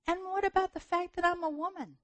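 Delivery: high, emphatic question; incredulous objection. Retold or imitated. Preacher objecting to God, high and incredulous. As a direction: protest in disbelief. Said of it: "I'm like".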